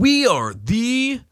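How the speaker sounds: very high, dragged-out mock voice; mocking stupid voice. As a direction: imitate the co-host in a silly high drawl. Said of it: "I'll do it in your stupid voice".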